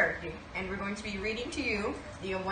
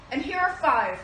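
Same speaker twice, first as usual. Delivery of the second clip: calling out; 5 semitones higher, 6 dB louder than usual. Acted. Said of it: "called Purdy".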